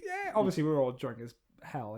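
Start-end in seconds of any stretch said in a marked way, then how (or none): 0.0-0.3 high, held-back mock crowd cheer. Friend retelling the crowd's reaction to the music, softly mock cheering. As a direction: imitate an excited crowd without the volume. Imitating the audience as music starts.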